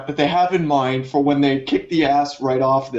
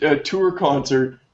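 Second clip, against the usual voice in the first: ordinary talk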